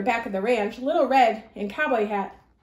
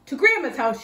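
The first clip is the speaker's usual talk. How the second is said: loud rallying shout; girl shouting to her pony as they ride away; 3 semitones higher, 6 dB louder than usual. Acted. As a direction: call it out as she gallops off. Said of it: "she shouted".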